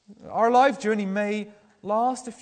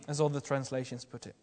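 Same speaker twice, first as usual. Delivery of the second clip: usual speaking voice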